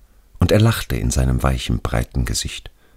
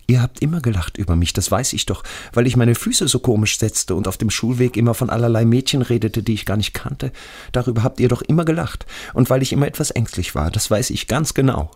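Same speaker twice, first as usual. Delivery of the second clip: hohe, schnelle, vorwurfsvolle Jungenstimme; ängstlicher toter Schulfreund; 9 semitones higher, faster than usual. Acted. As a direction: als toter Freund aufgeregt rechtfertigen, deutlich höher. Voices dead friend Radi. (hoch, gekränkt und hastig)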